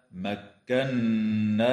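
مكناكم with the doubled نّ held in a long nasal hum; nasal ghunna demo. Shown as a demonstration. Teacher demonstrating the ghunna on مكناكم, slow and chanted. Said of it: "وتغن النون المشددة في كلمة".

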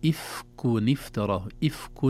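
إفك افترى with the tanween nun sounded as ifkun-iftara; nun pronounced clearly. Shown as a demonstration. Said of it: "النون ظهرت".

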